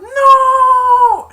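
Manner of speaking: very high, long loud wail; screamed no. Retold or imitated. Barkley tearing off his helmet, screamed protest. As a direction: scream it in mock disbelief. Imitates player's cry of disbelief.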